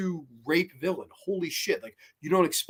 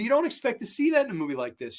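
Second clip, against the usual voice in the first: ordinary talk, nothing marked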